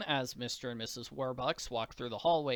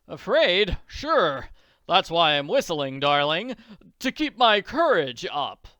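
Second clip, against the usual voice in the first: loud, hearty husband; breezy mock-bravado; 3 semitones higher, 10 dB louder than usual. Husband pretending to whistle for courage, breezy. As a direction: brush off your wife with booming mock sincerity.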